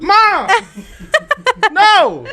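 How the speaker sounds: high, loud laughing cry of protest; laughing protest shout. Retold or imitated. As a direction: yell at mom in laughing horror. Protesting mom's movie choice.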